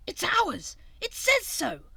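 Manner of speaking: high, loud delighted cry; high excited exclamation; thrilled cry. A narrator's reading. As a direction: burst out with joy. Friends thrilled at sledge.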